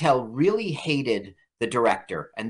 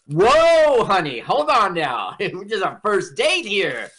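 high, loud whoa, then easing down; hammed-up panicked backpedalling on a date; mock alarm; 6 semitones higher, 4 dB louder, slower than usual. Acting out alarmed man on date.

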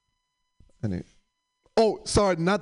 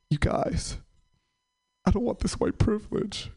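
mock tearful; 6 semitones higher than usual. Coworker losing it at diversity training, mock tearful. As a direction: start voiceless, then rise into a fake-tearful plea. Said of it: "really getting really emotional".

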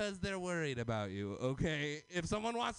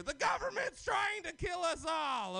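mock conspiracy rant; 8 semitones higher, 3 dB louder than usual.

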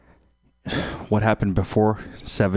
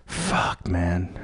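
whispered curse; 4 dB quieter than usual. Real, not acted. Calling the dealer who won't deliver, a whispered curse. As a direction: hiss it out in frustration. Frustrated outburst while calling.

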